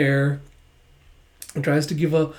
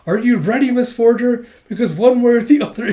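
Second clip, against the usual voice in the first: higher and louder, building fast; dramatic challenge; 6 semitones higher, 6 dB louder, faster than usual. Retold or imitated. Becky challenging Miss Forger, dramatic raised voice. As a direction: throw down the gauntlet.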